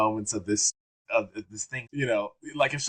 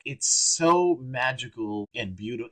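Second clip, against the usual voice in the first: usual speaking voice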